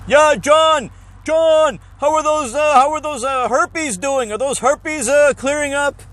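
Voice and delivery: high, blaring announcer-style doctor; booming doctor voice. Retold or imitated. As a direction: broadcast the patient's private problem to the whole building. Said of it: "you can hear him throughout the whole fucking building".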